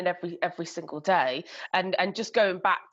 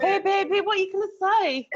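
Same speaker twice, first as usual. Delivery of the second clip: put-on playful high Jamaican accent voice; 12 semitones higher, 4 dB louder than usual. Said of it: "Is that your Jamaican accent".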